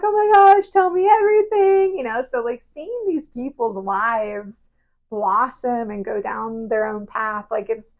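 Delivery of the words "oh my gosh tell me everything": high and loud, gushing with joy; overjoyed, much higher and louder; excited gush. Retold or imitated. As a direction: beg for the whole story, thrilled. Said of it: "we're just so happy".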